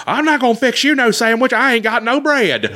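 high, whiny put-on server voice; high-pitched mock counter girl; Subway worker. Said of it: "She's like".

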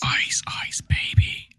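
breathy whispered sing-song. Pretend. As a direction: whisper it like a secret hook. Whispered joke after segment title.

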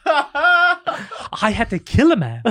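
high, very slow camp gush through laughter; camp Joe Pesci. Pretend. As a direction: swoon over the violent role. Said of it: "And he goes".

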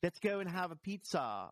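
put-on girlfriend's affected English accent. Girl greeting Harvey in a play, English accent. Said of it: "a girl with the English accent".

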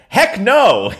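retold loud, high-pitched refusal; emphatic refusal. Panelist refusing to cede control, emphatic. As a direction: blurt it out as an emphatic quote.

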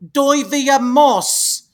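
Doivia Moss in a hammy foreign accent, drawn out very slowly; overdone foreign pronunciation. Shown as a demonstration. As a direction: stretch Doivia Moss out in a vague fake accent. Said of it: "I'm gonna pronounce it with an accent".

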